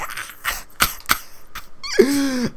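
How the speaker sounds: wheezing, high-pitched mock laugh; breathless squeak of laughter, imitated; imitated helpless laughter. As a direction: act out laughing till the tears flow. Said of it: "our eyes were just flooding with tears".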